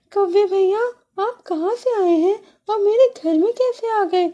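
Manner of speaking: high, quick timid sparrow voice; a small bird nervously asking; small sparrow, high and hesitant; little sparrow. Acted.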